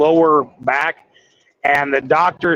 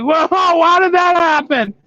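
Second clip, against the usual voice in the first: mock surprise; 13 semitones higher than usual. Trucker finding the cookie box in his hand, mock surprise. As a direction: act baffled at your own weakness.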